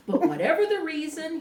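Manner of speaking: plain reading voice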